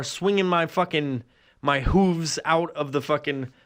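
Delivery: ordinary talk, nothing marked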